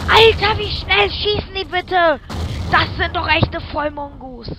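loud high gasping disbelief; shocked gasp and yelling. Real, not acted. Shocked by enemy fire.

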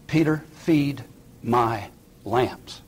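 "Peter, feed my lambs" very slow, word by word; measured, deliberate commission, a long gap between "feed", "my", "lambs"; solemn charge. Acted. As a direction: set "feed", "my" and "lambs" apart with a pause after each. Jesus's reply repeated for weight.

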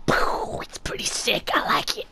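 unvoiced whispered aside. Real, not acted. Streamer admiring the spell to viewers, whispered. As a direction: breathe it out as a secret.

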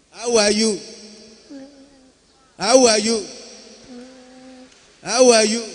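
How are you asked loudly, HOW stressed and high; prompting question. Shown as a demonstration. Pastor cueing the boy to answer, loud clear prompt. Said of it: "When I say, "How are you?".

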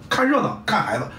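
normal talking voice

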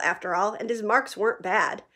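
ordinary talk, nothing marked

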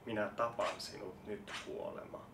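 soft whispered threat. Acted. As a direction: breathe it into its jaws. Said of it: "Aion kuiskata sen kitaan".

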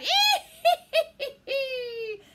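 high, loud hee-hee cackle; witch's cackle. Acted. Witch flying through the stormy wind, cackling. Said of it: "The witch laughed out loud".

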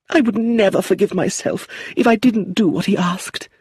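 aunt frets over her son's telegram; anxious old aunt. Acted. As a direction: plead as a worried mother. Aunt Lucilla's voice.

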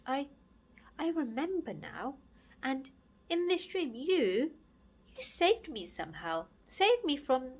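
high, halting, wavering recollection; quivering, breaking voice. Acted. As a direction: falter through it, close to tears. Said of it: "his voice began to quiver and break".

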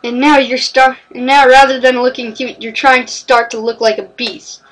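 usual speaking voice

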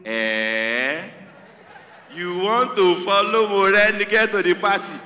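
mock-permit it with menace and a laugh; sarcastic mock permission. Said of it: "And she says".

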